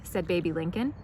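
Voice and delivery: usual speaking voice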